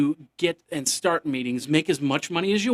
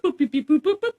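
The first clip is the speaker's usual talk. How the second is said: high sing-song beep boop; playful robot beeping climbing higher; mock computer beeps; 12 semitones higher, 4 dB louder than usual. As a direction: chirp it like a scanning machine. Imitating facial recognition computer.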